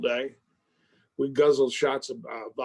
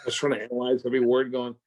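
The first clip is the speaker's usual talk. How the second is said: usual speaking voice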